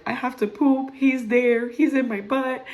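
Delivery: high-pitched, urgent mock wail; mock panic. Laboring mother telling the nurse, high mock panic. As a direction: plead as if you can't hold on, hammed-up.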